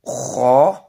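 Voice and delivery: kho, rasped from the throat; letter kho demo. Shown as a demonstration. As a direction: rasp out kho from the top of the throat. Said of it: "kita dikenalkan dengan huruf".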